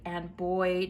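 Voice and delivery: ordinary talk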